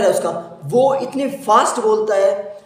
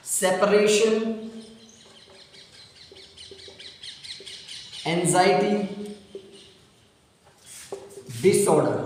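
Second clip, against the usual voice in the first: slow dictation; 5 dB quieter, slower than usual. Shown as a demonstration. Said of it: "teacher dictates term word by word".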